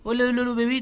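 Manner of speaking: ordinary talk, nothing marked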